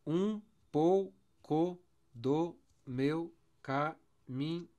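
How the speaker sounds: very slow, a gap after each syllable; deliberate syllable-by-syllable scansion; separated syllables. Shown as a demonstration. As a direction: count the line beat by beat. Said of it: "Vamos ver?".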